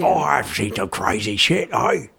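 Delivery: a hint of a botched Scottish accent, drifting toward pirate from Australia; failed Scottish accent. Retelling the sergeant's line to his friend, in a failed Scottish accent. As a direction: try for a Scottish sergeant and miss. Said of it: "That wasn't Scottish".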